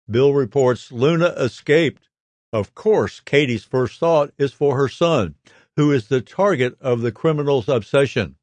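normal talking voice

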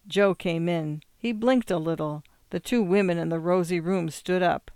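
plain reading voice

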